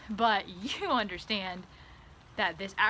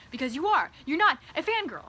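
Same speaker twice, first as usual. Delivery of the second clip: louder, higher, forced insistence; insistent denial; 5 semitones higher, 5 dB louder than usual. Retold or imitated. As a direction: insist too hard you're fine. Insisting on own sanity.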